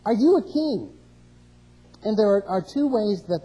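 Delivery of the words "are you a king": loud, high shouted question; yelled question. Retold or imitated. Pilate yelling at Jesus as he walks in, loud. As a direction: shout it across the room at Jesus. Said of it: "he literally yells to Jesus".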